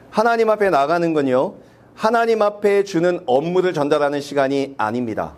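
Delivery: normal talking voice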